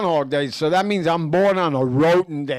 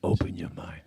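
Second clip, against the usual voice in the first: breathy whispered movie line; whispering; 10 semitones lower, 9 dB quieter than usual. Acted. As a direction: whisper it like a secret. Total Recall quote, whispered.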